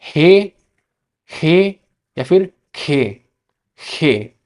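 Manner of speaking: हे then खे, Spanish "ge" with a throaty kh; Spanish letter name. Shown as a demonstration. Teacher to students, two ways of the letter name. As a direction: show both हे and खे clearly. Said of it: "जी को क्या कहते हैं".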